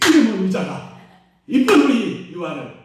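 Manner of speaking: loud pretend telling-off; play-acted scolding, fading at the end; mock scolding. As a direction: scold the chair for a toddler's sake. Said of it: "이렇게 책망해주잖아".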